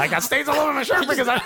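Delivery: high, quick, hammed-up comic whine; self-mocking fat guy. Diner mocking himself for stains on his shirt, hammed-up fat guy. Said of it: "I'm just a fat guy walking around".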